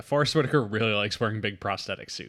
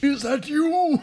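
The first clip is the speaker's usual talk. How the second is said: high mock greeting; 13 semitones higher, 4 dB louder, slower than usual.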